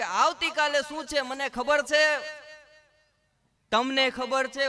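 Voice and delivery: a playful nagging wife voice; wife's voice. Retold or imitated. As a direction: tease him like a fishing wife.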